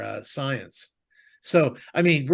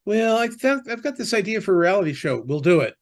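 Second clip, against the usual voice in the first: higher-pitched mock sales pitch; eager show pitcher; 7 semitones higher, 4 dB louder, faster than usual. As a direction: imitate someone keenly pitching an idea, voice raised high. Voicing an eager reality show pitch.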